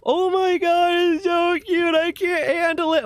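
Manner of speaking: very high, drawn-out imitation of an upset date; mock distraught. Upset date gushing over how cute his dog is, mock distraught. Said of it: "she seems sad about it".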